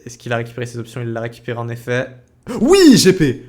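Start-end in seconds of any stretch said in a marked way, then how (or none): 2.6-3.4 high, loud victory shout; excited cheer. Real, not acted. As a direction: celebrate the kill. Caster cheers a kill.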